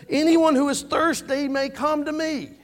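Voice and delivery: high, loud preacher's cry; imitated shout. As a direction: call it out so the whole crowd hears. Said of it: "If Jesus shouted this, he wanted people to hear".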